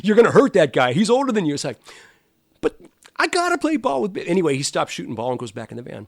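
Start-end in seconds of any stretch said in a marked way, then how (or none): 0.0-1.5 louder, higher, a mock scolding wife; wife's warning. Wife warns him not to go play, mock scolding. 2.6-4.3 put-on much higher, a pleading child's whine; whiny kid. Pleading with his wife to go play ball with Biff, a childish whine.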